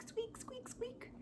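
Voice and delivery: very high, whispered squeaks; mouse squeak. Retold or imitated. Imagining the mouse in the room, tiny high whispered squeaks.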